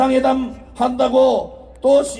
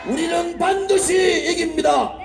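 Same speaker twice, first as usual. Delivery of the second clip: high, fervent closing exhortation; rallying cry; 4 semitones higher than usual. Real, not acted. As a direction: whip the crowd up to fight.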